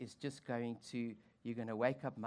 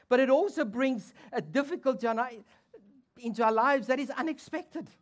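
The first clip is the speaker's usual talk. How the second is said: louder, higher preacher's push; rising preaching; 9 semitones higher, 8 dB louder than usual. Acted. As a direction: drive the sermon upward with force. Preaching builds in force.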